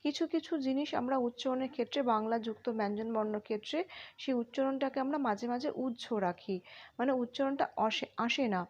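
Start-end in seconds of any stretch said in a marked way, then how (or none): none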